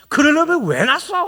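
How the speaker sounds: put-on a child shouting back at parents; children talking back. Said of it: "자녀들이 뭐라고 말해요". (부모에게 대드는 아이의 큰 소리)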